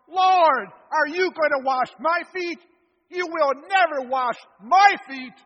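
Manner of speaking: loud, high outcry of protest; cries out. Acted. Peter recoiling as Jesus kneels, crying out loud. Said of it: "Peter cries out".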